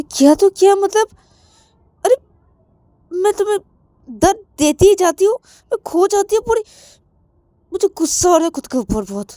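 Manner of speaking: high, loud, upset outburst; angry at herself, the words spilling out fast; self-directed anger. Acted. Shruti, furious with herself, rants to Shreya.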